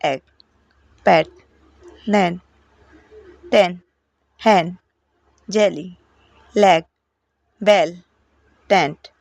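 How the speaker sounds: each word said with a quick short e, like एग; short e sound. Shown as a demonstration. Teacher drilling the short e words. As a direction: keep the e in लेग, बेल short. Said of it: "तो short में".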